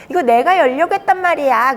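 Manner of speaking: put-on high, loud childish complaint; 항의하는 아이. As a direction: protest like a thwarted little kid. 아이가 아빠에게 항의하는 장면. (억울한 아이처럼 높고 크게)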